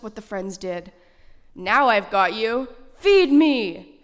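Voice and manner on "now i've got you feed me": louder and louder, greedy at the end; triumphant shout. Acted. Foxy Loxy shouting at the trapped friends as he slams the door. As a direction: bellow the last demand. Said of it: "shouted Foxy Loxy".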